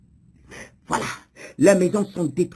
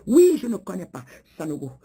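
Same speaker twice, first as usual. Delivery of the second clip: ordinary talk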